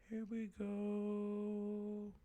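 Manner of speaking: soft, very drawn-out chant; mock seance incantation. Host starts the butter seance, soft slow chant. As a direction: stretch it out as if entering a trance.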